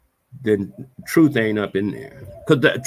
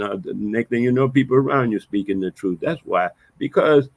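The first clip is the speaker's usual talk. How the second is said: normal talking voice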